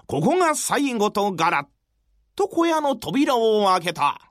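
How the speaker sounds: ordinary delivery, nothing marked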